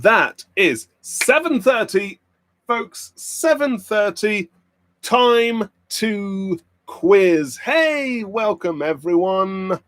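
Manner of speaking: high, drawn-out host's welcome; quiz host announcement. Real, not acted. Streamer opening the pub quiz for everyone, quiz host announcement. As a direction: stretch the words out to open the show.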